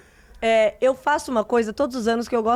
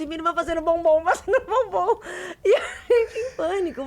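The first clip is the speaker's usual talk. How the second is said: high, laughing and near tears; recalling the chaotic Easter rush, laughing; 7 semitones higher than usual. Real, not acted. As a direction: crack up while telling the panic. Panic retold, laughing.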